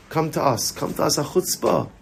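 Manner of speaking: usual speaking voice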